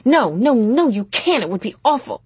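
high, loud run of refusals, easing off; loud teenage objection; horrified protest. A narrator's reading. Prudence refuses mother's offer.

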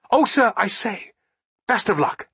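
high, chirpy English gentleman; cheery Englishman. A narrator's reading. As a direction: lightly chirp a polite farewell. Fremantle turns back, wishes luck.

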